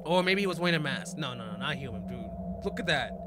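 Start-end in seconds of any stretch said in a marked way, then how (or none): none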